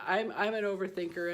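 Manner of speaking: ordinary talk, nothing marked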